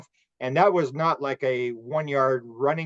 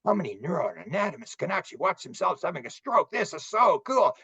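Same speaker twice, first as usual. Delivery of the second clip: awed right brain; 4 semitones higher than usual. Retold or imitated. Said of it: "the right brain is saying".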